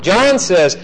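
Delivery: ordinary talk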